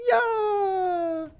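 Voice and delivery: high, excited shout of joy; excited yell. Real, not acted. Excited cheer in game.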